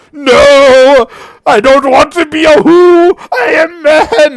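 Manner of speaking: high, loud wailing cry; sobbing dragonborn. Acted. Dragonborn sobbing back at the Serpent King, high wailing shout. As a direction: shout it through tears, desperate to keep his name. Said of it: "As the dragonborn sobs".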